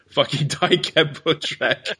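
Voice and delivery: slow, laughing through the words; drawn out with real laughter; laughing read-out. Real, not acted. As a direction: read the death threat as a joke, cracking up. Reading hostile message, amused.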